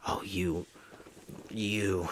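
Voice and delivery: whispered, seething at the man who burned him; angry whisper. Retold or imitated. Burned Roman to Joker, seething whisper. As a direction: hiss the words with quiet rage. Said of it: "really mad at him".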